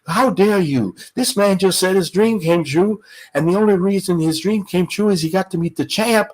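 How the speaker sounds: high-pitched, earnest reprimand; Ali. Retold or imitated. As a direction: plead the old man's case, rising in pitch. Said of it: "I could overhear him say this".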